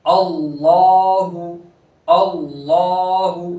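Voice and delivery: slow recitation with a full, heavy लाम in अल्लाहु; heavy lam recitation. Shown as a demonstration. Teacher demonstrates full lam of Allah to students. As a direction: chant अल्लाहु slowly with a thick lam. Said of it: "लाम को पूर करेंगे".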